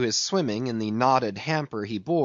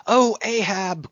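high, slow, pleading call; crying out; 7 semitones higher, 3 dB louder than usual. A narrator's reading. Starbuck calls to Ahab from the ship, crying out. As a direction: call out high and drawn-out in appeal. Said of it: "cried Starbuck".

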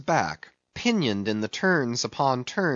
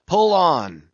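higher, louder order to row; urging command; 4 semitones higher, 6 dB louder than usual. A narrator's reading. Ahab drives his rowers on, loudly commanding. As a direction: call out hard to the oarsmen.

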